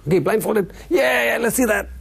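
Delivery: high, laughing crowd voice; excited doubting crowd. Retold or imitated. Crowd daring the daredevil, high and laughing. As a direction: egg him on like a skeptical crowd.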